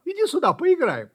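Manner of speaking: high loud playful call. Acted. Puppy shouts up to the kitten to play. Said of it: "Щенок кричал".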